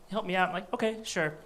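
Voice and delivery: normal talking voice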